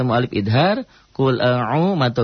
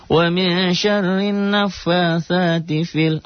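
high slow chant stretching fi; recitation demo, long vowel; 7 semitones higher, much slower than usual. Shown as a demonstration. Teacher showing the correct long reading, high chant. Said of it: "Padahal itu matobi'i".

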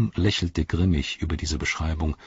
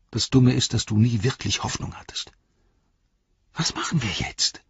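higher voice, partly whispered, scolding himself; reproachful inner voice; 5 semitones higher than usual. A narrator's reading. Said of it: "tief aus seinem Innern den Vorwurf".

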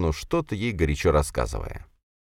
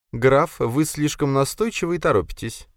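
high-pitched coquettish reproof; coy lady; 9 semitones higher than usual. A narrator's reading. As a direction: gently rein in a pushy suitor, pitched high. Lady checks the count's advances.

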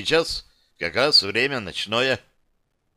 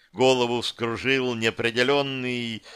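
ordinary delivery

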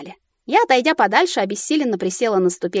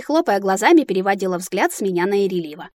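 ordinary delivery

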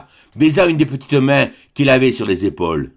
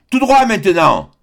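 higher, louder cheerful shout; cheerful call; 6 semitones higher, 3 dB louder than usual. A narrator's reading. Young man gaily calls directions to the husband, cheerful shout. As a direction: call out gaily across the water. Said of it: "lui cria gaiement le jeune homme".

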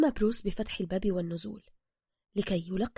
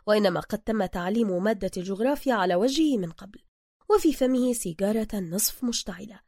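ordinary delivery, nothing marked

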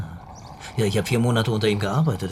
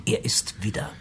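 whisper; 4 dB quieter than usual. Real, not acted. Realisation spoken under breath.

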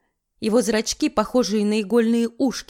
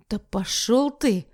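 hissed defiance; slower than usual. Acted. Defiant youth snaps back.

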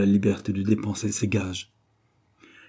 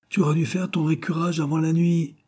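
high-pitched, plucky reproach; emboldened cry; 8 semitones higher than usual. A narrator's reading. Husband working up courage against wife, raised cry. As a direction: call it out to summon courage. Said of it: "cria-t-il pour se donner un peu de courage".

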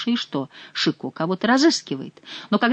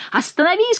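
high, sharp order to halt; commanding shout; 12 semitones higher than usual. A narrator's reading. Chicot shouts at the fleeing monk, commanding. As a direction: shout him to a stop. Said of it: "кричал Шико".

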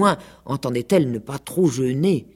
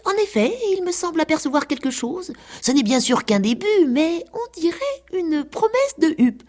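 much higher, gentle cat voice; cat pretending to see a crest, much higher; kindly cat; 13 semitones higher than usual. A narrator's reading. Cat humours the pig.

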